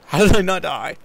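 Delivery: loud, high yelp of disbelief; high, very loud astonishment; startled amazement. Real, not acted. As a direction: cry out in shock at surviving. Startled by near death in game.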